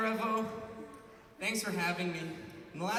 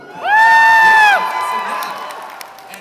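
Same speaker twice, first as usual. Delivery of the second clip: cheering yell; 20 dB louder, much slower than usual. Real, not acted. Cheering at the bar.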